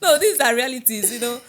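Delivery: laughing protest. Real, not acted. Laughing at being called a prophet.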